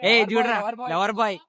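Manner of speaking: high playful teasing call. Real, not acted. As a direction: tease him across the street. Teasing friend heading to girlfriend.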